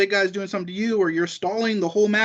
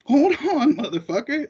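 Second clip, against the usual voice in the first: laughing mock threat; 7 semitones higher than usual. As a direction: bark the warning again, half laughing. Repeated warning, louder, laughing.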